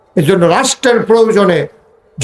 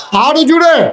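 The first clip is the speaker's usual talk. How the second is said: high-pitched call to the crowd; high, urgent shout; rallying shout; 8 semitones higher than usual. Real, not acted. Urging crowd to respond louder.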